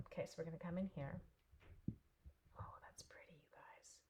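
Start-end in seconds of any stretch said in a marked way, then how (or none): 2.6-4.0 breathy hushed awe; soft marveling whisper. Real, not acted. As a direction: whisper it in delight. Admiring craft quietly.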